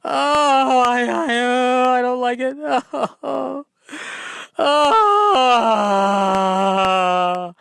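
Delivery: high whimpering bird cry; imitating a whiny bird mating call. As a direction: moan like a bird that hates it, high. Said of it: "their bird call is".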